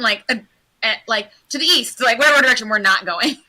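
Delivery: ordinary talk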